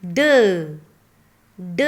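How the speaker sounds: ड said as a short retroflex duh, repeated; teacher repeating the sound ड; phonic sound demo. Shown as a demonstration. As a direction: model ड for kids. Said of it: "Sound of D is".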